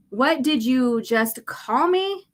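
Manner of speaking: slow, indignant question; offended slow challenge. Acted. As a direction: challenge him slowly, offended. Offended reply, Luke.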